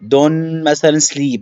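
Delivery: normal talking voice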